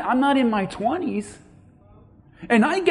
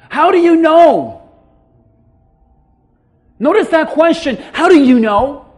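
loud, raised-pitch sermon delivery; full-force preaching; 4 semitones higher, 7 dB louder than usual. Real, not acted. Preacher warning congregation about tomorrow's plans, full-force preaching. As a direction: press the warning hard on the listeners.

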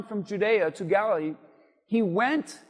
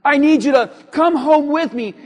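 loud, higher mock demand; bossy instruction; 4 semitones higher, 8 dB louder than usual. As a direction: act out insisting on your own way. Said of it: "You see, that's the how".

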